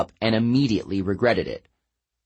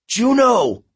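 calling out loud; 13 semitones higher, 6 dB louder than usual. A narrator's reading. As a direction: call out high and loud to a goddess.

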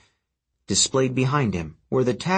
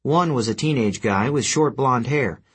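plain reading voice

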